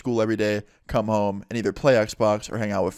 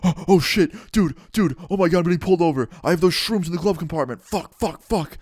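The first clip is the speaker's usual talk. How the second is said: high-pitched, panicked imitation of a friend; freaking out; 6 semitones higher than usual. Driver panicking to his friends as police pull him over, imitated freak-out. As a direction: act out a frantic, blurted panic. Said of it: "Donnie starts freaking the fuck out".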